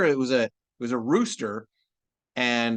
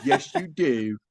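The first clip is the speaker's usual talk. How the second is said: sister's mocking reply; 2 semitones higher, slower than usual. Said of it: "She says".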